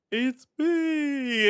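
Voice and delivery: high, strange creature voice, imitated; weird monster voice. Monster greets the waiting audience, weird high voice. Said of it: "weird voice".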